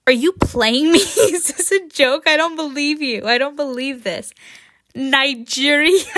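loud, high disbelief breaking into laughter; incredulous. Real, not acted. Podcaster seeing Nigeria in the listener stats, incredulous.

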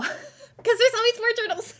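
laughing through the words, pitch jumping up. Real, not acted. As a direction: giggle it out, amused at the pile. Laughing about endless journals.

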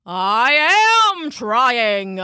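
put-on drawn-out old Papa grumble; grumbling. A narrator's reading. Papa answers Mama's plea to try, grumbling. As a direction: grumble it with exasperated effort. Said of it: "he grumbled".

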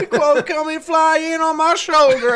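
Deep South Poe. Acted. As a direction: stretch it out like a Southern yokel. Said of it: "Edgar Allan Poe if he was from the Deep South".

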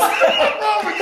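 slow, high laughing outburst; laughing disbelief. Real, not acted. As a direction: cry it out through laughter at him. Laughing disbelief at friend's order.

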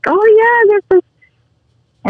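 high, bright-eyed delight at the ring, pitched well up; excited. Retold or imitated. Retelling her delight at the ring in the shop, pitched high. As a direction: gush over the ring in a high voice. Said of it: "And I was like".

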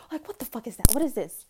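loud, sharp disbelief; incredulous outburst. Real, not acted. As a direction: explode at the absurdity. Outburst at the president.

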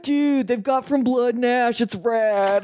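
lightly voiced stoned surfer; a hint of a laid-back surfer; stoned surfer dude. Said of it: "I'm, like, super stoned".

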